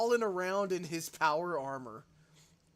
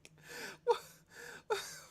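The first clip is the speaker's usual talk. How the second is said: high-pitched giggling laugh; a high breathy giggle fit; 4 dB quieter than usual. Real, not acted. Laughing at trailer.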